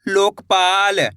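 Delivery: Lokpal very slow, long ओ and आ; joined-up demonstration. Shown as a demonstration. Teacher blending the letters for learners, Lokpal slowly drawn out. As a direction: stretch both halves of Lokpal. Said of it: "तो मिलके बन जाएगा".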